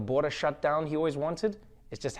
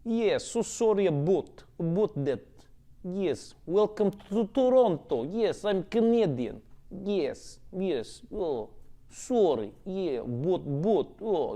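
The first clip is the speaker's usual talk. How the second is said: put-on higher, slow, odd Canadian accent; slow, higher, made-up Canadian accent, very apologetic; 4 semitones higher, slower than usual. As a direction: invent a wrong Canadian accent on purpose. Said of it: "making up random accents that are incorrect".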